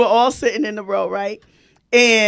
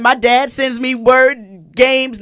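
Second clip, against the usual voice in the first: ordinary talk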